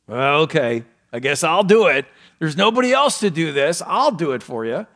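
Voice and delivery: mock the reluctant helper; reluctant volunteer. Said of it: "what is their attitude".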